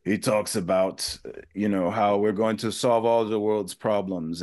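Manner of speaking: a hint of a German accent, mildly mocking; Klaus Schwab German accent. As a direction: imitate a German executive's accent, half-mocking. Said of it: "this German accent and he talks like this".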